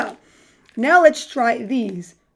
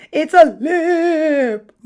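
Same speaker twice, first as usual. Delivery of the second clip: laughing; 3 semitones higher, 6 dB louder, much slower than usual. Real, not acted. Gummy shaped like lips.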